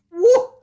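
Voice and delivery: loud, high hot woo. Acted. Host whoops about the heat, high and loud. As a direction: let out a sweaty whoop.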